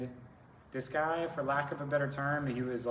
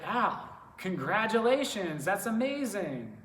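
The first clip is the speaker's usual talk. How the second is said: flattering enthusiasm; 7 semitones higher, 4 dB louder, slower than usual. Retold or imitated. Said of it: "Pumped him up a little bit".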